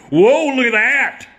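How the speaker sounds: high, loud, slow "whoa" to the crowd; milk the gag for the house; mock amazed. Old comic breaking character to hype a gag, amazed. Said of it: "looks at the audience and goes".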